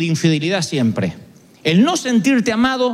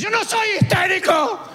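high, shrieking denial, pitched up and straining; mock hysterical shout; 10 semitones higher than usual. Counselee shrieking he is not hysterical. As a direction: yell that you're not hysterical. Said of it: "Gente que te dice".

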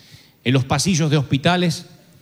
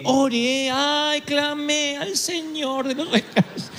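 mock desperate prayer; 10 semitones higher, 3 dB quieter, slower than usual. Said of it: "yo estaba tan desesperado".

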